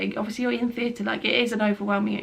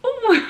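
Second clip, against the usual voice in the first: a much higher, louder cry of "Oh"; sharp pained oh; 14 semitones higher, 7 dB louder than usual. Retold or imitated. Patient at the worst injection, sharp cry. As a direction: yelp the oh as pain spikes. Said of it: "and then it was like".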